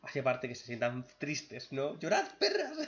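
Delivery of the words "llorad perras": mock shout, much higher; grito agudo de broma. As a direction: yell it in a squeaky joking voice. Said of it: "Steven se despide de las gemas". (grito burlón muy agudo)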